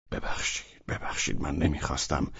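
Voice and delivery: whispered, hurried sorry; hushed apology. Acted. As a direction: take it back softly. Velchaninov apologises.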